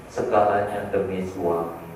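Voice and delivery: usual speaking voice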